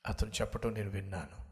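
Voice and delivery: usual speaking voice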